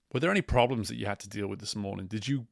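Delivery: ordinary delivery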